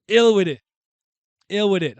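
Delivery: high, loud, punched hype line; hype call. Real, not acted. Streamer hyping his favorite character, loud hype chant. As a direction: shout it like a hype man.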